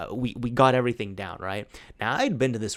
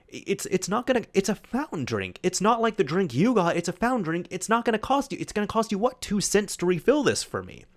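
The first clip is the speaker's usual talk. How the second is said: higher-pitched, mildly exasperated protest; a little annoyed; 8 semitones higher than usual. Retold or imitated. Friend protesting that a fountain refill costs two cents, a little annoyed. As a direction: imitate a friend arguing his case with mild annoyance. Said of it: "just a little bit annoyed".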